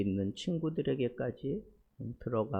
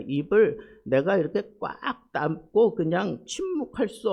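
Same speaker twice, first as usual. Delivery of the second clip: a preacher warming up, a bit louder; preaching; 5 semitones higher, 5 dB louder than usual. Acted. Pastor explaining Job's anguish to the congregation, fervent preaching. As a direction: press the point to the congregation.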